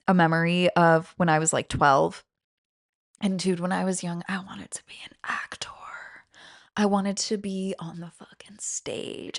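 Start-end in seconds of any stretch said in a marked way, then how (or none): 3.2-9.4 hushed confession. Retold or imitated. Wistful confession of childhood dream.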